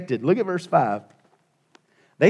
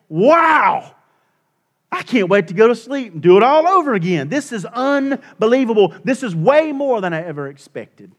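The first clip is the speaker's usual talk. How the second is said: higher and louder, bursting with wonder; thrilled exclaiming; 7 semitones higher, 7 dB louder than usual. Retold or imitated. Believer marvelling at bedtime over God's day, thrilled and loud. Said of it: "you think to yourself".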